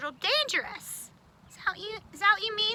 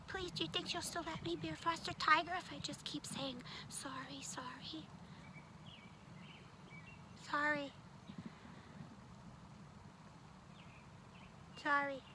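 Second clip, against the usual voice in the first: soft plea fading to a whisper; pleading to whispered sorry; 4 semitones lower, 19 dB quieter than usual. Acted. Puppet begging Missus Brown, whispered apology. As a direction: shrink into a tiny whisper of sorry.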